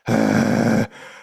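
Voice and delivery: low rumbling sigh from the throat; deep heavy sigh. Shown as a demonstration. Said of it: "When we do that".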